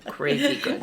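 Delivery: high and giggly; laughing. Real, not acted. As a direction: gush through a laugh. Laughing, gushing about the piece.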